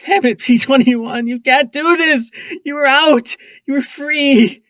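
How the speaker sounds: high, loud anguished shouting; desperate yell. A narrator's reading. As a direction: yell at him as if he can hear. Shouting at hanged friend.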